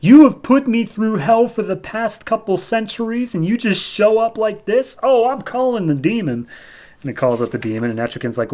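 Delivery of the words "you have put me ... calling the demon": high, loud mock exasperation; acted indignant outburst. Jason fed up with the false Merlin, acted outrage. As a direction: rant it high, like you're fed up.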